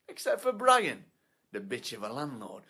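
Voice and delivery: usual speaking voice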